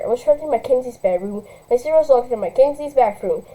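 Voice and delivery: plain reading voice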